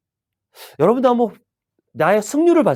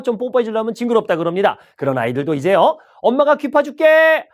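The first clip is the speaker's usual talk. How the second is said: subway peddler; 3 semitones higher than usual. Shown as a demonstration. 지하철 승객들에게 물건 파는 잡상인 말투. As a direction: sell it like a subway vendor working the car. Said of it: "제가 지하철 잡상에 좀 변신을 좀 할게요".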